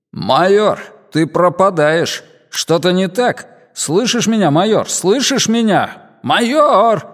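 rising worried calls into a failing link; alarmed calling. Acted. Flight control losing contact with the major, increasingly alarmed. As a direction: call out urgently as contact slips away.

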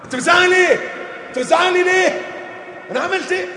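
high, loud, indignant shriek; a wife snapping back at her shouting husband; hammed-up angry wife; wife yelling back.

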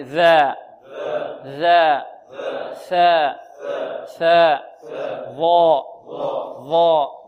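ذا، ثاء، ظا each alone, tongue tip at upper teeth; articulation drill. Shown as a demonstration. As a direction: sound out ذا، ثاء، ظا separately for students to repeat. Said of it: "تخرج من طرف اللسان مع طرف الثنايا". (نطق ذا، ثاء، ظا من طرف اللسان مع الثنايا)

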